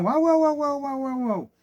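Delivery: high yapping barks from a tiny dog; yappy dog barks. Retold or imitated. Imitating the neighbor's tiny dog barking nonstop, high yapping. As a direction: yap like a scared little dog.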